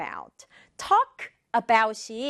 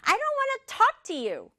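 high-pitched acted huff; snapping back; 12 semitones higher, much slower than usual. Sister glaring at her brother, snappy high retort. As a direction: snap it in a high, sulky voice. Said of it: "지율이가 막 쏘아보면서".

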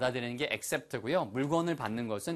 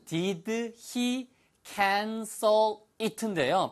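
very slow, word by word, pitched up; slow demonstration; 6 semitones higher, much slower than usual. Shown as a demonstration. Teacher showing learners the phrase slowly, slow demonstration. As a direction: draw out each word of the question separately. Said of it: "천천히 하면".

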